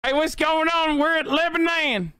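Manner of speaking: a put-on tour host hello; mock vlogger greeting. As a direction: play a cheesy vlogger arriving in town.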